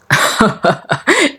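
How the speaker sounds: short acted chuckle; amused laugh. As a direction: laugh at the child's cheek. Oday laughs at the girl's cheek. (ضحكة خفيفة)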